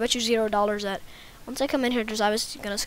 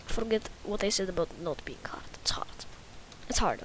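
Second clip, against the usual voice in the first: put-on Russian accent, trailing softer; 5 dB quieter than usual. Shown as a demonstration. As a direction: concede defeat in a mock Russian accent. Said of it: "gonna just talk in Russian accent".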